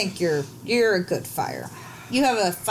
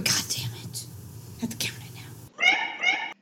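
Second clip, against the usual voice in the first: whispering; 7 dB quieter than usual. Acted. Vampire stuck counting spilled salt.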